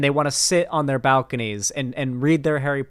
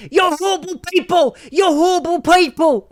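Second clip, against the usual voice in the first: high shrill-pitched mock yell; hammed-up screeching imitation; mocking shriek; 6 dB louder than usual. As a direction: shriek it like a hysterical protester. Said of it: "and screaming".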